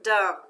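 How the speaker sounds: "Dumb" as one isolated, loud syllable; syllable read-out. Shown as a demonstration. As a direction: sound out "Dumb" firmly on its own. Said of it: "Second one".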